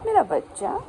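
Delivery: high squeaky pet talk. Real, not acted. Cooing at sulking dog.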